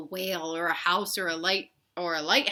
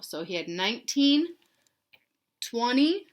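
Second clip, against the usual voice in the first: usual speaking voice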